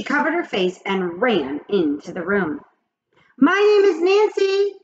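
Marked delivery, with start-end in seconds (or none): 3.4-4.7 put-on calls out loud and high after the girl; calling after. A narrator's reading. Nancy calling after Mac as she runs off, high call. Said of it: "Nancy called after her".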